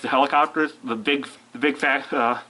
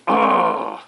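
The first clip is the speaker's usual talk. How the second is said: deep playful monster growl. Pretend. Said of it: "gotta stop doing that".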